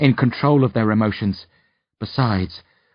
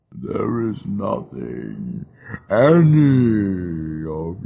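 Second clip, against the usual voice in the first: mournful demon; much slower than usual. A narrator's reading. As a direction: lament mortal ignorance slowly. Demon eyes the narrator.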